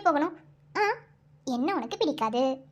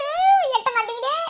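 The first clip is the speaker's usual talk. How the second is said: higher, strained laugh while reaching; laughing complaint while reaching up; 7 semitones higher, 5 dB louder than usual. Acted. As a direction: laugh as you stretch for it.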